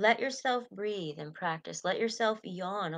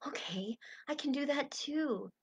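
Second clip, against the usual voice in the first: relieved realization; 6 semitones higher, 4 dB quieter than usual. Retold or imitated. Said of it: "they go".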